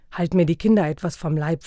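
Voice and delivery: plain reading voice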